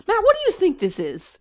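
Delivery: high, loud baffled question; bewildered demand. Retold or imitated. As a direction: call out to Matt, totally baffled. Retelling asking Matt about picture.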